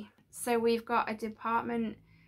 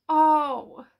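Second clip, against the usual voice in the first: awed; 4 semitones higher, 10 dB louder than usual. Real, not acted. The model lights up.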